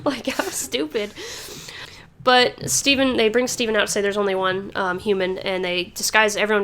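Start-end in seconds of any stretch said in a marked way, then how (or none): 0.0-1.1 high and loud, then a burst of laughter; laughing disbelief. Real, not acted. Host laughing at the clueless rubies.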